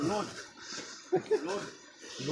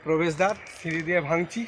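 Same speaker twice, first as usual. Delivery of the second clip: winded from climbing stairs; panting between words; out of breath; 5 semitones lower, 5 dB quieter than usual. Real, not acted. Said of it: "একটু হাঁপিয়ে পড়ছি অবশ্যই".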